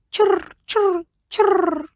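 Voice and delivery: high chirping churr churr; high bird call churr. A narrator's reading. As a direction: chirp it like an annoyed little bird. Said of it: "it said".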